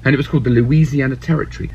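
normal talking voice

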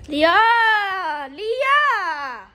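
loud call across room. Real, not acted. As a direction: call her name loudly. Calling sister loudly.

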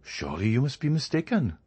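mildly astonished, pitch climbing through the line; much surprised. A narrator's reading. Journalist answering his offended friend, much surprised. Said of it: "much surprised".

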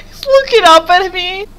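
high squealing words through laughter; wheezing high laugh-talk; shrieking laughter. Real, not acted. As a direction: squeal as he looks up at you.

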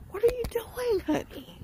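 squeaky high sing-song question; high put-on voice. Vlogger greets her viewers in a high, squeaky put-on voice. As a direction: pitch it way up, teasing.

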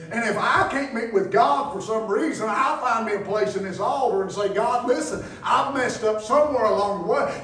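loud, high, ringing preacher's cry; shouting sermon in full flow; preaching at full force. Acted. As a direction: whip the congregation up from the pulpit.

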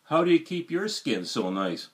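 usual speaking voice

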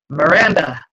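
sharp shout of her name; called out name. A narrator's reading. Father catching his daughter, a sharp shout. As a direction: bark the name across the room.

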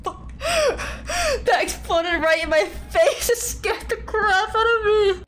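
jump-scared, then laugh it off while yelling; scared laughing shout. Real, not acted. Egg exploded in her face.

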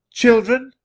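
high, loud call; a woman calling for lost children. A narrator's reading. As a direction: pitch it up and project. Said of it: "a woman called".

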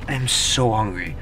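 ordinary talk, nothing marked

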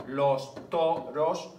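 stretched syllable by syllable; teacher scanning a verse aloud; slow scansion reading. Shown as a demonstration. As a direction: draw out each syllable of "los toros". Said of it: "luego aquí hay dos sin alefas".

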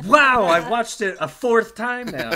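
very loud, high-pitched delight; amazed, laughing exclamation. Real, not acted. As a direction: blurt it out, thrilled by the impression. Amazed at the scream just performed.

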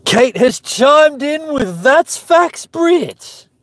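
chat read. Acted. Reads chat excitedly.

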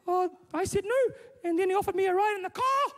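very high, loud mock girl voice; upset daughter. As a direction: wail it out as a hammed-up daughter. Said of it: "she's looking all dishevelled and upset".

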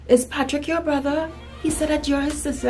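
high-pitched imitation of nosy questioners; mimicked questioning, pitched well up; schoolmates asking. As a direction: put on a high voice for the other kids. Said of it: "people would be like".

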